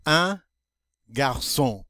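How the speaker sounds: ordinary talk, nothing marked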